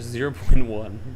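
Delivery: loud, slow disbelieving exclamation; shocked. Real, not acted. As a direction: bellow it in stunned disbelief. Shocked reading out low score.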